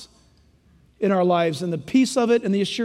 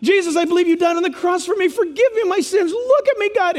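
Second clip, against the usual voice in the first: pleading prayer; 6 semitones higher than usual. Acted. Preacher voices a sinner crying out to God, high and pleading. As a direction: beg God, rising higher toward the end.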